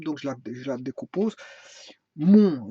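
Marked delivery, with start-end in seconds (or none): 2.2-2.7 "Mon" loud and held; one-syllable demo. Shown as a demonstration. Teacher counting syllables, "Mon" punched out. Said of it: "une syllabe".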